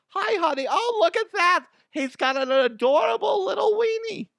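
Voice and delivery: very high, loud gushing mom. Pretend. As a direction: coo over something adorable like a mother. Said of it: "my mom voice is like".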